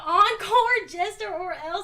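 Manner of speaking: shouted encore call; loud mock demand. Reading a viewer's demand, loud mock shout. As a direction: bark it like a heckler.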